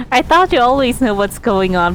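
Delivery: usual speaking voice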